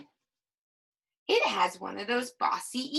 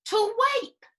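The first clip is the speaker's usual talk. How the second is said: "To wipe." much higher and louder, cheerful; confirming; 12 semitones higher, 5 dB louder than usual. Shown as a demonstration. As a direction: confirm it brightly, proud. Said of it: "confirming the word".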